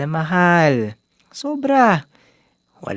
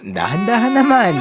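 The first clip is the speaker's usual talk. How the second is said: worried call; 7 semitones higher, 3 dB louder than usual. Acted. Worried about drunk girlfriend.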